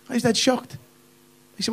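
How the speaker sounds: normal talking voice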